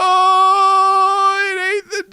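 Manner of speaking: put-on very high, slow crying child's voice; scared little girl. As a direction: imitate a frightened girl near tears. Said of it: "and Natalie goes".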